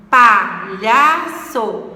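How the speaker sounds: "palhaço" very slowly split, pa, lha, ço; syllable by syllable. Shown as a demonstration. Teacher locating last and penultimate, "palhaço" in separate syllables. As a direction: punch each separate syllable of "palhaço" loudly. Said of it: "Temos a última, a penúltima".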